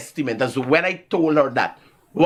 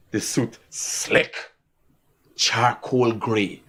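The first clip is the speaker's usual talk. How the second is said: savouring; 7 semitones lower, much slower than usual. Real, not acted. Savouring his suit.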